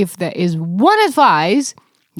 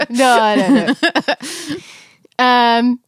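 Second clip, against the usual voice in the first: usual speaking voice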